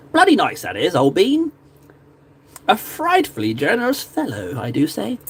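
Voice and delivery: old-bean British toff, hammed up; mock upper-crust British accent with swooping pitch. Praising a generous fellow in a hammed-up British accent. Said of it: "That's a very British way of saying it".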